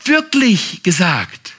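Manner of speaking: slow, drawn-out sly serpent question; a hint of the sly serpent, stretched out slowly. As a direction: tempt like the snake, lightly voiced. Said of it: "und sagt".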